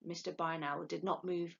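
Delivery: ordinary delivery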